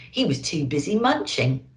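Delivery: usual speaking voice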